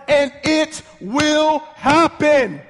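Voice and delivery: drawn-out, emphatic declaration; climactic preaching. Real, not acted. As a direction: build each word to a high peak. Climax of favourite verse.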